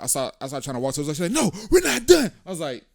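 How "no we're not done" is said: loud, high imitation of a furious partner; mimicked angry yell. Man imitating his girlfriend in a fight, yelling. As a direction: yell it as her, refusing to let go.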